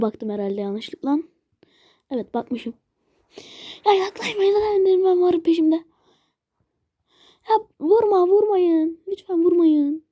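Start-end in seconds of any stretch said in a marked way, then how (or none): 3.9-5.8 mock crying. Enderman chasing, pleading. 7.5-10.0 whiny plea. Pretend. Begging mobs not to hit.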